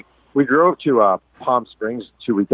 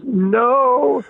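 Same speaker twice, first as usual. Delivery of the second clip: put-on very high, defiant child's no; stubborn little girl. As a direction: refuse like a scared but stubborn kid. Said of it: "And she goes".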